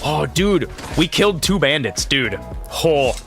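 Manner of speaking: ordinary talk, nothing marked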